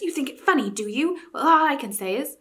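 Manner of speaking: mild huffy growl. A narrator's reading. Eustace grumbles at Jill's giggling, growled. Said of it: "growled Eustace".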